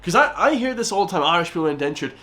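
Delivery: ordinary delivery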